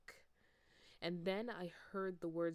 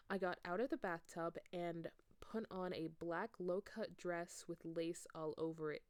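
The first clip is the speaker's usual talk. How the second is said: plain reading voice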